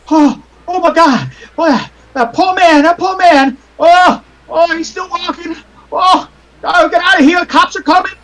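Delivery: high-pitched panicked crowd voices; acted high, frantic cries of alarm; people screaming. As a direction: wail like horrified onlookers in a fleeing crowd. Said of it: "you hear people screaming".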